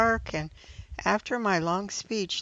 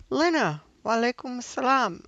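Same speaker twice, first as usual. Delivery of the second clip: higher and louder with delight; a delighted surprised greeting; 6 semitones higher, 3 dB louder than usual. Acted. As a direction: react as if she can't believe it's her. Eyes grow wide, surprise.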